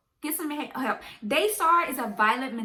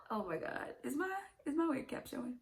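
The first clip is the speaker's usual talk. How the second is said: softer, hushed aside to herself; 13 dB quieter than usual. Real, not acted. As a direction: mutter it to yourself, embarrassed. Checks wig cap aside.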